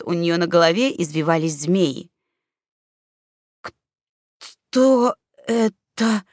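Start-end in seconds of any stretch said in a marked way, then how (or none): 4.4-6.2 slow, frightened, barely breathed question; a scared elf whispering, voice shaking; trembling whisper. A narrator's reading. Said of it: "дрожащим голосом прошептал". (дрожащий шёпот)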